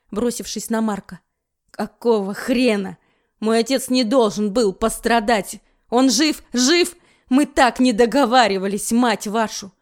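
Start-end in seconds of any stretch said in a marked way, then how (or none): 1.7-9.7 loud, frantic shouting; panicked yelling. Acted. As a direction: yell it in panic. Паника над телом отца. (истеричный крик)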